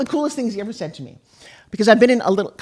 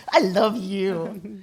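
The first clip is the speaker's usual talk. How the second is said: very slow, drawn-out and tender; tender reply; much slower than usual. Retold or imitated. Wife retells answering her husband's sweet words, slow and tender. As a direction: melt into the words.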